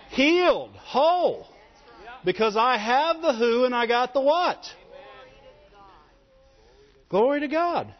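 preacher building to a triumphant climax; preaching at full force. Acted.

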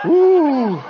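high gasp into a long "ooh"; hammed-up gasp of dread, pitched high; mock frightened gasp. Showing the congregation's expected response, mock frightened gasp. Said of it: "We should go".

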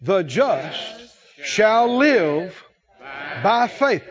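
very slow, deliberate scripture phrase; leading congregational recitation. Shown as a demonstration. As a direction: lead the congregation word by word, drawn out. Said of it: "say that last phrase out loud".

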